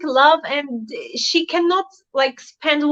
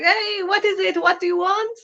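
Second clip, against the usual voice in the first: mildly put-on retort, raised pitch; imitating Barış; 7 semitones higher than usual. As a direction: quote him with a higher, questioning lift. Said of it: "Barış was like".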